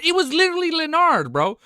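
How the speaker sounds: shouting in triumph; loud gleeful I was right; excited gloat. Real, not acted. Gloating at chat.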